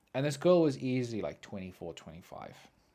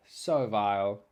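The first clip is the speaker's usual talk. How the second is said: plain reading voice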